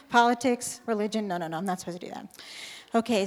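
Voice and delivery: ordinary delivery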